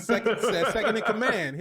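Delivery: words breaking up with laughter; laughing. Real, not acted. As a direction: say it through real laughter. Laughing about Kraglin.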